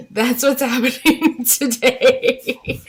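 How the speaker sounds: laughing through the words, slowed. Real, not acted. Mom laughing at herself still in pajamas, laughing.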